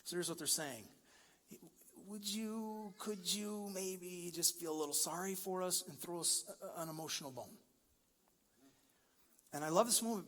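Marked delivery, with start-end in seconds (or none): 2.1-7.6 tentative plea. Retold or imitated. Voicing the lepers' timid, small request.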